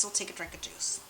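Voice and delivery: hushed whispered aside to herself; soft voiceless whisper. Shown as a demonstration. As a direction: breathe it out as a secret. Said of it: "repeats line, second time whispered".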